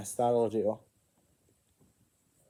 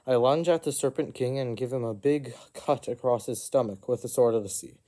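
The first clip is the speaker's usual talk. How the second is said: ordinary delivery, nothing marked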